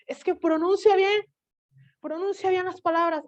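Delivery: high-pitched imitation of a nagging teacher; mock scolding teacher. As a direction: scold the kid like a fussy teacher. Teachers scolding her pronunciation.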